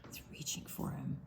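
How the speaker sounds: hushed whisper, building suspense. Real, not acted. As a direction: whisper like something sneaky comes. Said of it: "suspense whisper to kids".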